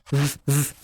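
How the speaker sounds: ف voiced, teeth on lip; buzzing V. Shown as a demonstration. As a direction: hold ف as a humming V. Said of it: "بتحط كده أسنانك على شفتك". (انطق «ف» V والأسنان على الشفة)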